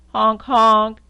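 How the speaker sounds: loud, blaring honks; loud horn honk. Acted. Reading to a child, honking the jeep horn loudly.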